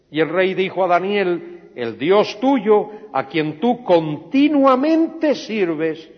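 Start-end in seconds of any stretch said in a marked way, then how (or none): 1.7-6.1 loud, slow, high proclamation; the king calls it out to the pit, loud and slow; king's solemn call. Acted. King to Daniel at the lions' den, a loud slow call.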